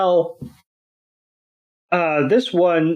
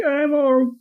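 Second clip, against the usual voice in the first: squeaky high little Pokemon voice, pitched way up; Axew; 10 semitones higher than usual. Retold or imitated. Axew answering Iris after fainting in a high squeak. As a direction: play the dazed little dragon squeaking out an excuse. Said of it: "And Axew's like".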